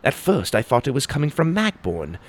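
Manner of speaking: ordinary delivery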